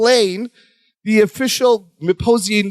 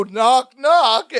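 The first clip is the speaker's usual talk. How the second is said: regal voice; 8 semitones higher than usual. Retold or imitated. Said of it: "we hear a voice outside the door go".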